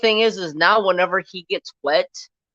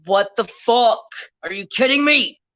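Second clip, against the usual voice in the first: retold outburst; 6 semitones higher, 4 dB louder than usual. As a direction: relive the moment you saw the foam. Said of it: "And I'm like".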